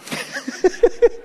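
a high unvoiced laugh; high breathy laugh. Real, not acted. Pastor laughs at his own joke to the congregation.

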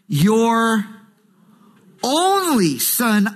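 "only" stretched out slowly after a pause; slow, weighty emphasis on "only"; stress on only. Retold or imitated. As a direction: pause after "your", then draw out "only". Stressing repeated phrase of scripture.

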